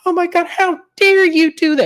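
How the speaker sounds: very high shocked squeal; mock outrage. Host imitating Brittany splashed with water, very high squeal. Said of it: "Brittany said".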